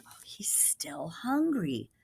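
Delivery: slow, soft whisper. Real, not acted. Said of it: "whispered aside".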